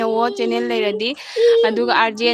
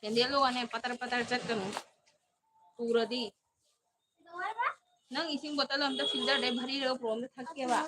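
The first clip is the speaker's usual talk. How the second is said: much softer, distant everyday voice; off-mic aside; 15 dB quieter than usual. Real, not acted. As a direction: talk to someone away from the microphone. Off-mic aside, asking for water.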